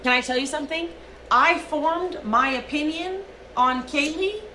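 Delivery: usual speaking voice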